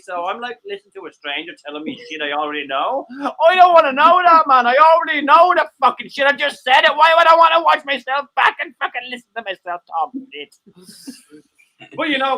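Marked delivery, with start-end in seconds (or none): 3.2-10.5 louder, higher fed-up rant; exasperated rant. Real, not acted. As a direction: vent it, fed up and loud. Ranting about hearing himself back.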